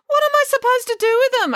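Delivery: put-on high, quick indignant girl; put-upon girl, pitched right up; indignant protest. A narrator's reading. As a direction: protest at speed in a high, loud voice. April protests at the bouquet.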